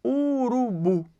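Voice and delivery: URUBU drawn out slowly and evenly; slow model word. Shown as a demonstration. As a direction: stretch out "urubu" for the class. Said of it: "Vamos pegar o termo". ("urubu" dito bem devagar)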